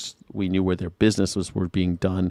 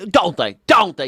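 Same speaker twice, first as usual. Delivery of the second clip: mock badgering; 8 semitones higher, 5 dB louder than usual. Mocking the senator's leading questions.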